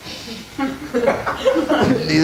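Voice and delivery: high rueful laugh fading off. Real, not acted. Rabbi laughing that the youth know Chaplin, a high rueful laugh. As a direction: let out a bitter little laugh.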